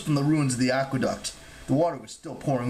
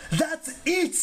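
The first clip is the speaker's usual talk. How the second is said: high-pitched, outraged god; fussy outrage, pitched way up; indignant cry. A narrator's reading. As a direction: cry out in protest. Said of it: "Terminius cried".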